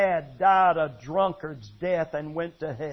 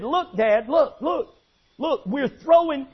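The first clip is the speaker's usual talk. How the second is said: urgent calls; 6 semitones higher, 4 dB louder than usual. Retold or imitated. Family pretending to fight fire.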